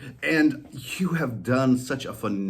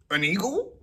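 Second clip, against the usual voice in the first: higher collie voice; dog suggesting; 10 semitones higher than usual. Acted. Molly suggesting an eagle, higher voice. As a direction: offer another idea. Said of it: "Molly suggested".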